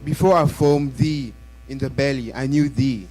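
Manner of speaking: normal talking voice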